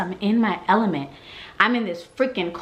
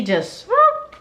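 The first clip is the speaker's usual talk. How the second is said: mock whoop; 3 dB louder than usual. Describing sudden exam.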